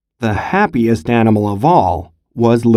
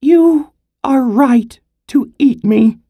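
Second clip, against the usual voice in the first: high squirrel voice pleading; a small squirrel talking its way out; 12 semitones higher than usual. Acted. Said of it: "he said".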